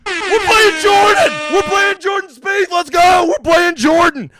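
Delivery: high, slow, shouted hype; hyped shouting. Real, not acted. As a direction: yell it like a fight announcer. Hyped announcement of golf pick.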